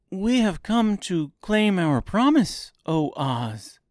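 high-voiced girl making a claim; girl character. A narrator's reading. Girl claiming the wizard's promise, high girl voice. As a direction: speak up as a little girl, pitched high. Narrator voices Dorothy addressing Oz.

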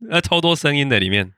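surprised. Real, not acted. Reacting to noisy clip.

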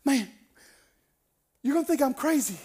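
high, loud, agitated Southern man. Retold or imitated. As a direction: blurt it out as a worked-up country man. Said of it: "This man's shaking".

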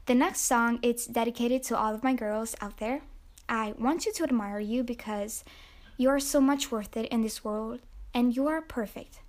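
ordinary talk, nothing marked

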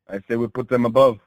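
ordinary delivery

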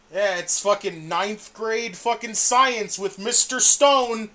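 loud, slow, fed-up rant; exasperated shout. Real, not acted. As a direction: yell it out in disbelief. Ranting about the name Mister Stone.

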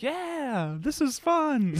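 high, put-on voice of a listener having fun; imitating a cheering listener. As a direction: play the excited fan yelling along. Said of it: "Are you like".

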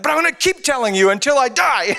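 high-pitched insistent protest; mock defiance. Mock defiant retort to niece.

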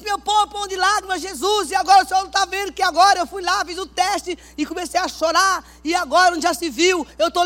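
queixa exaltada e aguda a Deus; oração dramática. Acted. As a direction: clame alto, como quem cobra Deus em prantos encenados. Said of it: "Eu sempre fui dramática". (clamor melodramático)

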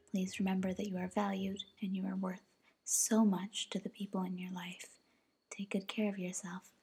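hushed, close whispering goodbye; soft ASMR sign-off. Real, not acted. Caring for stressed viewers at the end, hushed. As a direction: soothe the listener gently.

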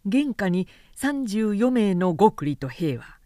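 ordinary delivery

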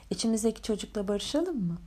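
normal talking voice

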